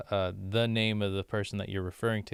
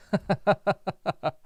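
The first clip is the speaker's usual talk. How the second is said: high, rapid staccato laugh; a quick run of high chuckles; amused high-pitched giggle; 9 semitones higher, 4 dB louder than usual. Real, not acted.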